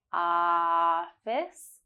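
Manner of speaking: "Office" with a long drawn-out ah; held ah. Shown as a demonstration. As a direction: stretch the ah of "Office". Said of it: "hold ah for three seconds".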